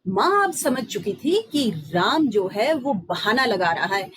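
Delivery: usual speaking voice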